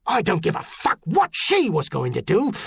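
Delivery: high, angry tirade; shouted, outraged dressing-down; furious bellow. A narrator's reading. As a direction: tear into a subordinate at full voice. Officer furiously cuts off trooper.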